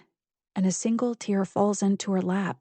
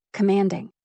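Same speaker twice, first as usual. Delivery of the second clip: plain reading voice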